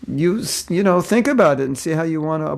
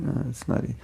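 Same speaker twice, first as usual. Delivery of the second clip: ordinary delivery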